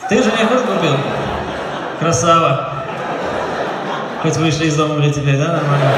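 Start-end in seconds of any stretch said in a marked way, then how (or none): none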